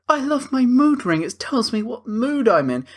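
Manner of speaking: high, simple-minded mock voice; mocking the husband. Friend mocks the husband's love for his mood ring, high and goofy. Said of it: "he's like".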